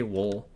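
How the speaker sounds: "wool" sounding like "wall"; own pronunciation. Shown as a demonstration. Player showing their own way, "wool" like "wol". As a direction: say "wool" with an aw-like vowel and dark l. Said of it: "I say wool".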